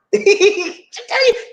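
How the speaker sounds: high giggling laugh. Real, not acted. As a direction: giggle high at your own joke. Laughs after Gullah demo.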